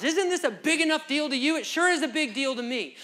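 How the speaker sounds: loud, high exasperated questions; exasperated spouse. Retold or imitated. Worried spouse loudly presses their partner to care. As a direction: demand the spouse feel it too. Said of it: "said that to your spouse".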